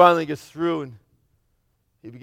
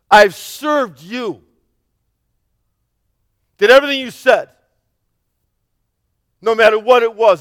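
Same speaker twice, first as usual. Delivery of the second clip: loud, higher indignant outburst, stretching all these years; angry older son; 4 semitones higher, 7 dB louder than usual. Acted. Older son confronting his father, loud and indignant. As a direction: hurl the years of service back at him. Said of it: "just angry".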